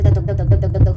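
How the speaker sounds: loud, thumping imitation of a racing heartbeat; pounding heartbeat. Telling how her heart raced waiting for the result, loud thumping heartbeat. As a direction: beat out each dhak like a heart about to burst. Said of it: "मेरी heart beat बहुत ज़्यादा तेज़ हो गई".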